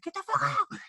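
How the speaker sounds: very high, held-back mock scream; mock screamed order, no real volume; imitated freak-out. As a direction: squeal it like an instructor losing it. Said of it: "He's, like, freaking out".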